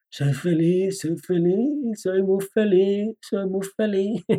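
high, slow, drawn-out half-hearted "soy feliz"; mock-bored mantra, sung out lazily; mock listless affirmations. Shown as a demonstration. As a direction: chant it slowly with no conviction at all. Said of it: "si las dices así con la energía y el garbo que tengo yo".

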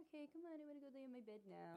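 high, quick, whiny dog voice; voiced sleepy dog. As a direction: plead for bed like a tired dog. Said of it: "he gets, like, this little look in his eyes".

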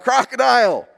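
high, urgent mock yell; retold yell. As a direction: imitate your own cry for help. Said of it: "and I yell out".

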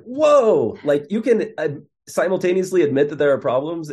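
retelling a stunned reaction to the answer; shocked disbelief. Retold or imitated. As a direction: gasp out the realization in astonishment. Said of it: "I was, like, in shock".

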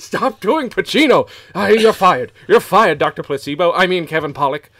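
loud, high-pitched exasperation; hammed-up furious dismissal; mock-angry firing. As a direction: yell as a fed-up boss sacking someone. Acted boss firing the actor.

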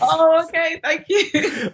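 high, giggly gratitude; delighted laughing thanks. Real, not acted. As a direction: accept the compliment with a pleased laugh. Guest thanked for being first.

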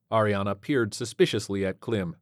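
ordinary delivery, nothing marked

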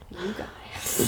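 quiet, let-down mother's sigh; disappointed mom voice. Retold or imitated. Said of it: "Disappointed mom voice".

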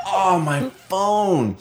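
high, slow dismayed "oh my phone"; high whiny realization. Retold or imitated. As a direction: drag it out high and slow, caught out. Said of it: "I'm like".

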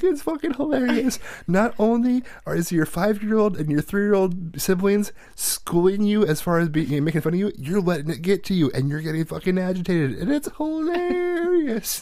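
high-pitched, tickled mockery; gleeful retort. Parent teasing son schooled by younger siblings, gleeful.

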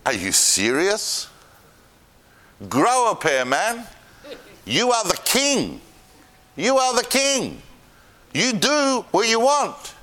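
scheming queen goading her husband, high and mocking; scornful wife. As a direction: egg on the sulking king with scorn. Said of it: "His wife goes".

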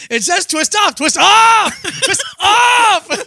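rising shout of frustration; Jerry screaming at a bottle. Retold or imitated. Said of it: "He's just screaming".

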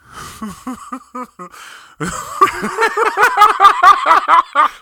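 long, high, loud laugh; high loud laughter. Real, not acted. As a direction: break into laughter. Laughing during plug.

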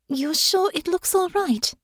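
put-on a worried girl asking for reassurance; nervous young Honor. Nervous Honor fusses with scarf.

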